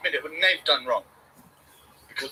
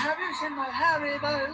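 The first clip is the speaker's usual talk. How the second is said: slow, pitched-up question; higher slower take; 9 semitones higher, slower than usual. Shown as a demonstration. Vlogger asking viewers, high slow playful question. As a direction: ask "Have you seen my Haribo?" high and slow. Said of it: "two takes".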